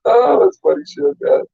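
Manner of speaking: real amusement, voice squeezed high; slow, high words through laughter; amused. Real, not acted. Amused reaction amid bursts of laughter.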